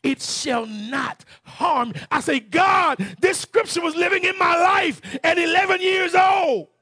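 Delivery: loud, high revival preaching; fiery pulpit proclamation; preaching at full force. Acted. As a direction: thunder the scripture out to the church.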